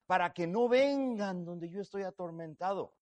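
a touch of a worried rich man begging; anxious rich man. Acted. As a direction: plead on behalf of his brothers. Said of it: "Él dijo".

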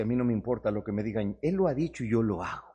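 low, calm and steady resolve; quiet firm reply. Acted. Peter quietly answering the mockers. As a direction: answer them quietly, unshaken.

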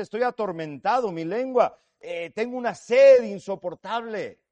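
anguished rich man calling from the flames; tormented rich man. Acted. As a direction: cry out like a soul in torment. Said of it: "porque él dice".

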